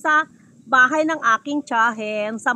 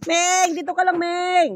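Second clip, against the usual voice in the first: calling out; 6 semitones higher, slower than usual. Real, not acted. Adult telling a child to stay here, calling out.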